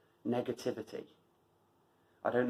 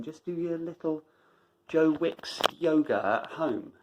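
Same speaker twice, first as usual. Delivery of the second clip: mocking tone; 4 semitones higher than usual. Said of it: "whose voice is it that says".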